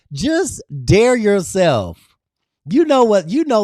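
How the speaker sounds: rally them with a burst of energy; fired-up exhortation. Real, not acted. Urging her viewers to dare themselves, fired-up and loud.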